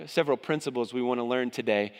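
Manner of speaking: ordinary talk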